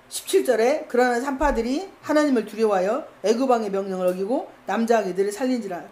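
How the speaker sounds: normal talking voice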